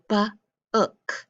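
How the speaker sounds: "b, ook" split after the first sound; blending book. Shown as a demonstration. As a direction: say "b", pause, then "ook". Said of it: "make the word book".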